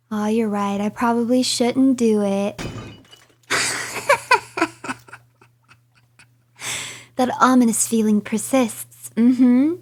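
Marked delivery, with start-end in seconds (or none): 3.5-4.9 high, breathy giggle; high snickering laugh; mischievous giggle. Real, not acted. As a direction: giggle quietly at your own mischief. Mischief after being warned.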